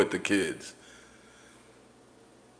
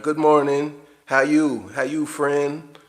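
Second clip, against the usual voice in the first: fake cheerful greeting; 4 semitones higher, slower than usual. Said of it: "Everybody walk around".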